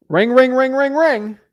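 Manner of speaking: phone ring imitation. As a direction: mimic a ringing phone.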